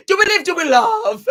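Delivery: high, loud words through real laughter; laughing. Real, not acted. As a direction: squeal it out while laughing.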